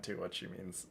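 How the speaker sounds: usual speaking voice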